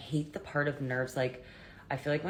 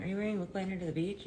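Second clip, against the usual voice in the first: viewer voice; 6 semitones higher, faster than usual. Pretend. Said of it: "If you're wondering".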